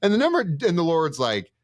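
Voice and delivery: ordinary talk, nothing marked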